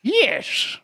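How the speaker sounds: high, slow Irish leprechaun lilt; Irish leprechaun accent. Acted. Friend's challenge, he attempts an Irish leprechaun accent. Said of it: "I'm sounding like a leprechaun".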